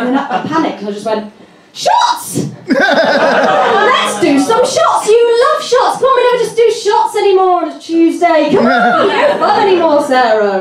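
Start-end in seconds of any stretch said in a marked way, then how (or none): 1.7-10.6 frantic shots pitch. Retold or imitated. To friend Sarah at the bar, frantic high party pleading. Panicked push for more shots.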